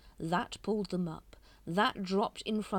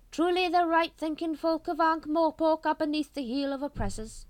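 raised, loud, grand oratory; pompous Grand Master; 11 semitones higher, 8 dB louder than usual. A narrator's reading. Grand Master soothing his brethren, pompous and loud. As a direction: declaim it like a sermon.

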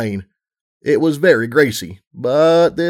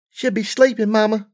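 put-on high, young filly voice; little filly voice; 9 semitones higher than usual. A narrator's reading. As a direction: voice a small child pony. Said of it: "Serenity said".

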